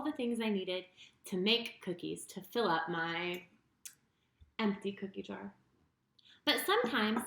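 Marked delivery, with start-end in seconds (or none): none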